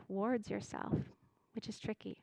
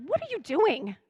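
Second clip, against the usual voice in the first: mock angry shout, pitched much higher; road rage yell; 8 semitones higher, 5 dB louder than usual. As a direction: snap at the driver who cut you off. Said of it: "pure anger comes out".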